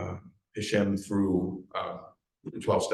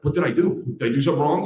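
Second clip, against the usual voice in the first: higher, louder worried questions; retold defensive panic; 6 semitones higher, 7 dB louder, faster than usual. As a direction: blurt it like someone fearing blame. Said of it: "when you're a drug addict and alcoholic".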